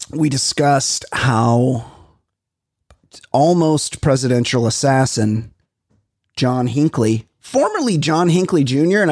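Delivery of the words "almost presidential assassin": usual speaking voice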